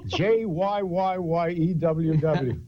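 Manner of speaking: "J Y Y Y E W W" stretched slow, letter by letter; "J Y Y Y E W W" spelled slowly and mockingly; drawn-out spelling. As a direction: drag out "J Y Y Y E W W" like a sneer. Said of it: "I never heard my name said like that".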